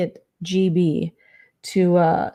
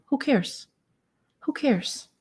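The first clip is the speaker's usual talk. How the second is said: dismissive; 6 semitones higher, 4 dB quieter than usual. Real, not acted. Dismissing the bangs fuss.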